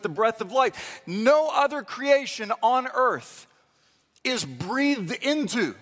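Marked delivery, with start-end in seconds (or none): none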